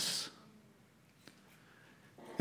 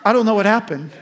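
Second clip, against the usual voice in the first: flustered laugh; 3 semitones higher than usual. Retold or imitated. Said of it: "I was like".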